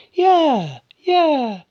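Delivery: very high, loud mock yeah; overdone high, falling cry; bad actress. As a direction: parody terrible acting in falsetto. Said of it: "the worst acting".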